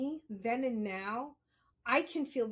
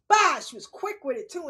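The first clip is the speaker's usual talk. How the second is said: imitated slap; 5 semitones higher, 10 dB louder than usual. Mother slapping her.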